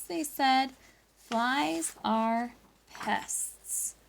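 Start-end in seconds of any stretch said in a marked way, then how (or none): 1.2-3.9 drawn-out disdainful verdict; teacher quoting the judges to the class, slowly; slow judges' verdict. Retold or imitated. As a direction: stretch each word slowly. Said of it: "it goes back to".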